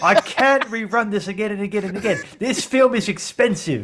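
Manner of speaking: high, exasperated Aussie director; Australian accent. Acted. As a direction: plead angrily in an Australian accent. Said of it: "I'm an Australian here".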